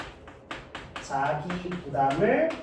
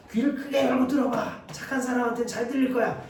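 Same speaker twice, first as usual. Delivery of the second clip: sarcastic reply; 7 semitones higher than usual. Acted. Teacher acts sarcastic reply.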